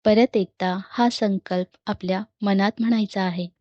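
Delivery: measured meditation guide voice with long pauses; guided meditation. Real, not acted. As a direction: guide listeners into calm, phrase by phrase. (ध्यान करवणारा शांत, संथ आवाज)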